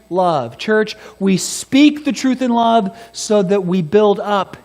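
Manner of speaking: fervent preaching. Acted. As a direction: preach it out to the church. Preaching at full force.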